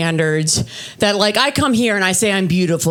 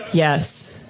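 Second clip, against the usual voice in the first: lower, deadpan yes; deadpan agreement; 4 semitones lower, 3 dB quieter than usual. Acted. Comic answering her boyfriend about German genes, deadpan. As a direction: agree ominously, underplayed.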